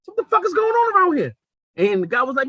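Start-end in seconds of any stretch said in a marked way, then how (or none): 0.0-1.3 high-pitched startled outburst; acted shock. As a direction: replay your stunned reaction, voice way up. Acts out his shocked reaction.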